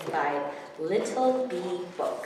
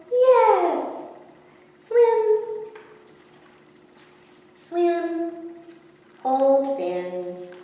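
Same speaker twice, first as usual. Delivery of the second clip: happy fish friends; 8 semitones higher, 7 dB louder, much slower than usual. Acted.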